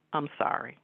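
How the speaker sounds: softened retold apology; changed, apologetic tone. Husband or boss backing down after being ignored, softened apology. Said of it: "he changed the tone of his voice".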